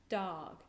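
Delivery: dog with the flat tongue tip on the teeth; broad Gaelic d. Shown as a demonstration. Gaelic teacher demonstrating the broad d sound. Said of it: "Say it a couple of times".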